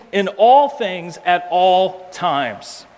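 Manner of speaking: In all things, at all times, drawn out slowly; weighty preacher emphasis; slow and emphatic. Shown as a demonstration. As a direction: spell out each phrase slowly. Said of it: "Paul is covering all the bases".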